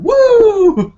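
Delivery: very high, loud drawn-out whoop; Ric Flair. Retold or imitated. Imitating neighbor yelling outside like a wrestler, high loud whoop. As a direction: let out a wrestler's piercing yell. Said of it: "I hear him yelling".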